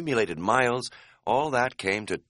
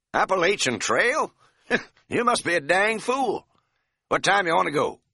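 high, loud country cabbie with a grin in it; brash Tennessee cabbie enjoying the joke; feisty cab driver; 10 semitones higher, 3 dB louder than usual. A narrator's reading. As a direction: play the cocky old driver haggling. Narrator voices feisty cab driver.

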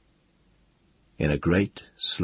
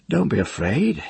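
high, smiling reassurance, slightly loud; mock-reassure him with a smile; smiling mockery; 8 semitones higher, 5 dB louder than usual. A narrator's reading. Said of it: "he said, smiling".

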